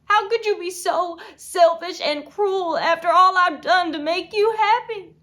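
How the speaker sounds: anger building to loud, high outburst; furious accusation. Acted. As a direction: hurl the accusation at the husband, loudly. Accusing husband of cruelty.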